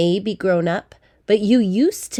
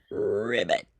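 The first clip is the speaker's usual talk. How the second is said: much lower, croaking frog voice; croaky frog; 6 semitones lower, 5 dB quieter than usual. Acted. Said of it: "if you spoke like a frog".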